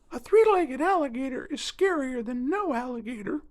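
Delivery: very high, light bird voice; spoonbill voice. Acted. Spoonbill introduces himself to the hurt alligator, birdlike. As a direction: chirp it like a small bird.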